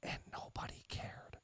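hushed, voiceless whisper; whispered aside for effect. Real, not acted. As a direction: drop right down to a whisper. Confiding a neglected fact, mock secretively.

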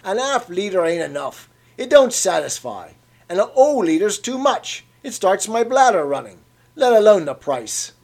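put-on an old man airing his grievances; complaining old Cockney. A narrator's reading.

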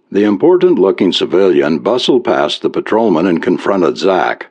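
ordinary delivery, nothing marked

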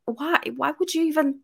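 hushed baffled why. Retold or imitated. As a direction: ask it in quiet disbelief. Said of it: "I'm like".

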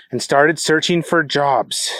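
ordinary delivery

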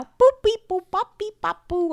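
high beeping computer noises; computer beeps. Pretend. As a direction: chirp like a computer. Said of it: "make that noise".

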